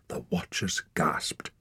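slow whispered line; hushed gasp. A narrator's reading. Narrator whispers the crowd's gasp, slow. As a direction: breathe it out slowly.

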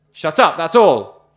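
the guard silencing the condemned man; furious roar. Acted. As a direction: crush him with a final yell.

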